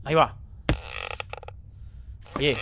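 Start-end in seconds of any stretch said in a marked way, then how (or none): none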